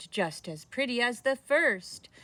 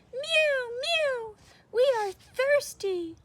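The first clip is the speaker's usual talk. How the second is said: high, squeaky kitten voice; mewing cats; 4 dB louder, slower than usual. Acted. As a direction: mew like a hungry kitten. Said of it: "cried the hundreds of cats".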